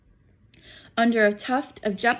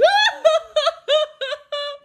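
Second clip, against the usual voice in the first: loud high howl like a wounded dog; howling wail; 9 dB louder than usual. Acted. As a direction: let out a howling sob. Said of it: "a howl like a wounded dog".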